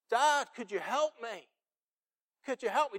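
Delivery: high pleading cry to Dad; child calling for help. Retold or imitated. Son to his father as he cries out, high urgent plea. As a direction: imitate a child begging for help, high-pitched. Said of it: "He called out and he said".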